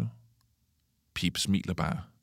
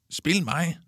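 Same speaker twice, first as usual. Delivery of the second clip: high, lightly raised call; 9 semitones higher than usual. A narrator's reading. Kalle calling to Otto for the ball, calling out. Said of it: "råber Kalle".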